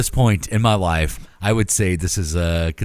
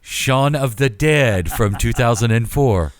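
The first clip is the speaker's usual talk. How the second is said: slow and deliberate listing; slower than usual. Shown as a demonstration. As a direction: read each word out slowly. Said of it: "I'll slow down for the rest of these".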